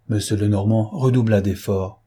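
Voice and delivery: ordinary delivery, nothing marked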